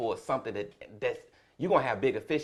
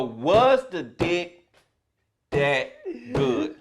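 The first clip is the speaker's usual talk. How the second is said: slow, with a long pause before good; drawn-out punchline; 5 semitones higher, 4 dB louder, much slower than usual. Retold or imitated. Comedian retelling question to daughter, slow with a pause. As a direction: stretch it out, then pop good up high.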